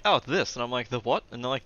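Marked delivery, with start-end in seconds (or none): 0.0-0.5 louder, higher offhand announcement; mock-casual, punchy statement; imitating vague people. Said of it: "when people are like". 0.9-1.2 loud, rising "The what?"; questioning repeat. Retold or imitated. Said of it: "I keep repeating in a questionable, like, manner".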